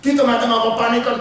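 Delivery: usual speaking voice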